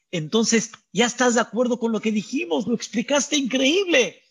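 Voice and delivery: eager and delighted, fast; lightly voiced pleased questioners; pleased scholars. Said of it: "Le decían Bet Shammai a Betilel".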